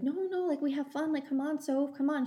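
high-pitched imitation of a coaxing mother; pleading mom. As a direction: retell her pleading, voice lifted high. Mom pleading with daughter, retold.

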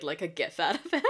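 normal talking voice

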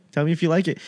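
usual speaking voice

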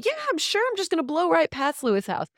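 high, scoffing sarcastic tone. Shown as a demonstration. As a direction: toss it off like you don't believe a word. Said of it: "say things sarcastically".

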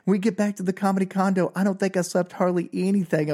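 ordinary delivery

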